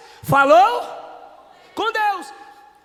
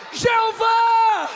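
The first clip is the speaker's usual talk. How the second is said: fervent cry; 6 semitones higher, much slower than usual. Real, not acted. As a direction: cry out to God with all you have. Preacher calling out God's name. (clamor alto e fervoroso)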